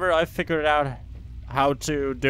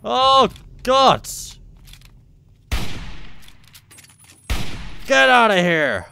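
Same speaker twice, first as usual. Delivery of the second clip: panicked yelling; 9 semitones higher, 6 dB louder than usual. Real, not acted. Surprised by enemy in game.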